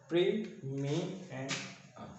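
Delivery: "प्री, मी and अम" softly spaced out; syllable by syllable. Shown as a demonstration. Teacher reading back the finished outline, "प्री, मी and अम" in pieces. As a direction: say "प्री, मी and अम" with a break after each syllable. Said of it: "तीन syllables में इसकी आवाज़".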